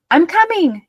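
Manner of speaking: put-on high, loud child's yell. A narrator's reading. Marta yelling to Pearl as she dashes out, loud. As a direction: shout it while running out. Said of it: "yelled Marta".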